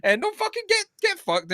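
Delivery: high-pitched outraged telling-off, laughing through it; mock indignant rant. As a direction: tear into the cold caller. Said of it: "she says".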